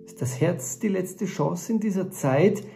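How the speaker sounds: measured spoken-word recital with long pauses between phrases; poem recitation. Acted. Author performing his text about truth and peace, poem recitation.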